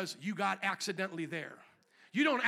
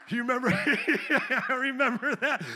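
laughing; 3 semitones higher, 7 dB louder, slower than usual. Real, not acted. Laughing recalling Hulk smashing Loki.

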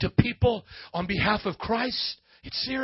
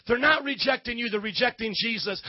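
normal talking voice

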